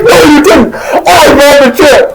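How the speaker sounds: high-pitched acted indignation; hammed-up heated retort; arguing back. Host disputes who bought the chip, indignantly.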